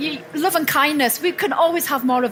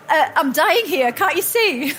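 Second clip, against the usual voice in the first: laughing mock panic; 4 semitones higher than usual. Telling the chatty doctor she is dying, laughing. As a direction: laugh while faking desperation. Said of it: "I was like".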